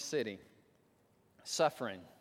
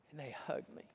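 near-whisper, soft and moved; hushed tenderness; 9 dB quieter than usual. Retold or imitated. Daughter telling how her parents hugged her, hushed. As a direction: let the voice drop to a whisper.

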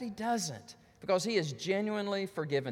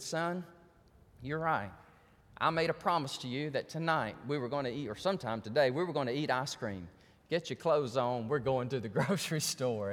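low, subdued fatherly voice; gentle father owning up; 7 semitones lower, 4 dB quieter than usual. Retold or imitated. Father making good on a promise to his son, gentle and low.

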